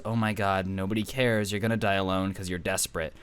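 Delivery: very quiet, low muttered jab; low, soft, muttered insult from the quiet kid; under his breath. Retold or imitated. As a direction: say it under your breath so she barely hears. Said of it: "very quietly under his breath goes".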